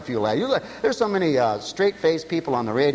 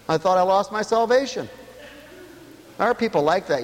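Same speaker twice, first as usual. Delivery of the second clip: usual speaking voice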